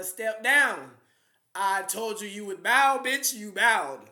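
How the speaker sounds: triumphant taunt, voice up; gloating shout. Real, not acted. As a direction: rub the victory in his face, loud. Gloating at Fauci, stepped down.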